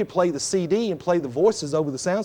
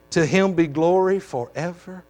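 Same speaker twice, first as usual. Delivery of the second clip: ordinary delivery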